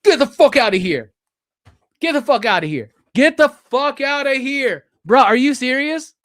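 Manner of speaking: loud, amazed exclamations; stunned disbelief. Real, not acted. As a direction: exclaim it loudly, unable to believe it. Stunned by the gift he unwraps.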